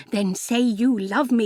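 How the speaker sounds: ordinary delivery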